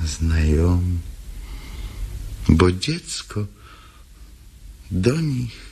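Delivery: usual speaking voice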